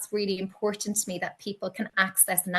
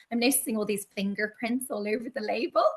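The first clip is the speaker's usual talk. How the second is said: ordinary talk, nothing marked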